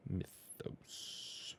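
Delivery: whispered, drawn-out word; absent-minded whisper to oneself; under the breath. Real, not acted. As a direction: sound it out in a whisper while busy. Typing while sounding out title.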